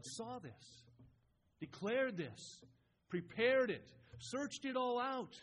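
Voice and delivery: high, slow, punched-out phrases; emphatic preaching. Real, not acted. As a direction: hammer each verb from the pulpit. Preacher hammers out a list of verbs.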